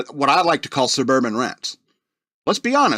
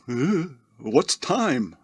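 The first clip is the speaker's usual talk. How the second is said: very slow, dazed, softer sloth voice; dopey sloth; 5 dB quieter, much slower than usual. Pretend. Sloth hanging in a tree all day, very slow and dopey. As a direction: drag each word like a half-asleep sloth.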